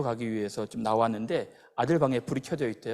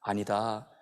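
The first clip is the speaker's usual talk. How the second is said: God answering; 6 semitones lower, 5 dB quieter, much slower than usual. Retold or imitated. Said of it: "근데 하나님이".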